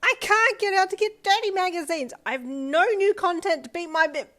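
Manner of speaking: high loud whining wail; desperate complaint; deranged boy's whining rant; frantic rant. A narrator's reading. Deranged boy ranting.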